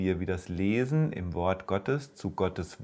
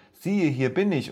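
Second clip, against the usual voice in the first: put-on higher, eager boy's voice; young Samuel; 7 semitones higher, 4 dB louder than usual. Samuel answering the call he hears, higher eager boy voice. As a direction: answer brightly like a willing boy.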